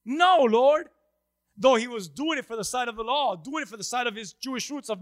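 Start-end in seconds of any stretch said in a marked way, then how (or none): 0.0-0.9 loud, high, defiant refusal. Acted. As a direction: fling it back at the Lord in defiance. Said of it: "Peter's reply was a defiant".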